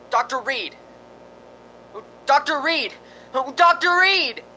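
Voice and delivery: rising high frantic shouts; calling for help. Acted. Smith shouting upstairs for Doctor Reed, rising panicky calls.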